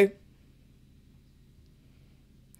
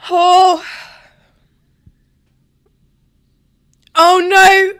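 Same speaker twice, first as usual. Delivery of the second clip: nervous gasp; 8 semitones higher, 9 dB louder, much slower than usual. Real, not acted. Ferrari drivers go side by side.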